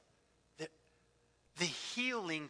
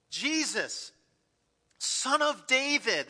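crying out; 6 semitones higher, 6 dB louder, slower than usual. Retold or imitated. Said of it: "they cried out".

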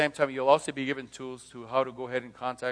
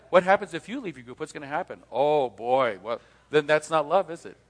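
normal talking voice

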